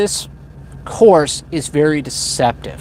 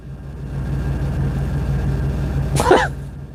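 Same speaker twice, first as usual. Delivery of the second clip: soft laugh; 5 dB quieter than usual. Real, not acted. Laughing at maybe nobody watching.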